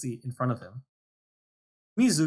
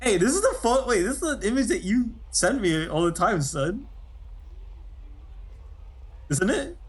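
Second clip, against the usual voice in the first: excited; 9 semitones higher than usual. Real, not acted. Excited recognition, high pitched.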